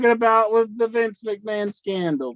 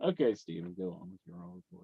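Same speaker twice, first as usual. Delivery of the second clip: sudden calm; 14 semitones lower, 15 dB quieter, much faster than usual. Acted. Sudden calm after tirade.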